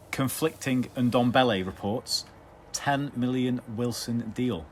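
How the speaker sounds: slow announcer headline read. Shown as a demonstration. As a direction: read it out like a news bulletin. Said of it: "get your chops around this one".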